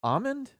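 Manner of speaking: ALMOND as AH-mund, rising very high. Real, not acted. Host baffled by the rule, ALMOND asked high as AH-mund. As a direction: question ALMOND without L in disbelief. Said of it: "I've never done that before".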